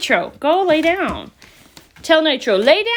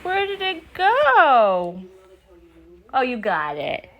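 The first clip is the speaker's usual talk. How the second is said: playful; slower than usual. Real, not acted. Playful peekaboo with toddler.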